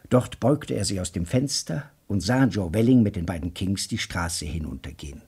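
ordinary talk

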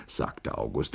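usual speaking voice

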